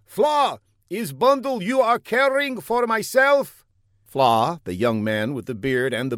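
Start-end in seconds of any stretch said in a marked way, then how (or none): 0.0-3.6 high, put-on foreign voice, calling out; a silly secret agent calling across the street; high comic foreigner. A narrator's reading. Older agent calls to Fla down the block, high comic voice.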